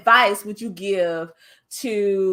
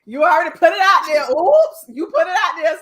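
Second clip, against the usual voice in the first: excited teasing; 7 semitones higher, 6 dB louder than usual. Real, not acted. Teasing excitement about TED talk.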